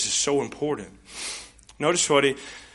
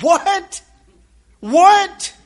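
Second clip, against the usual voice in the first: high, loud double take of disbelief; stunned, raised repeated question; incredulous what; 12 dB louder than usual. Real, not acted. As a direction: react as if you can't believe your ears. Pastor's easy salvation story.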